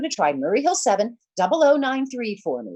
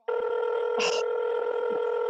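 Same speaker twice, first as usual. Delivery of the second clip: a long, breathy sigh of frustration; exasperated sigh; 10 semitones higher, 6 dB quieter than usual. Acted. Stranded caller sighing alone on the line, exasperated sigh. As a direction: let the air out in despair.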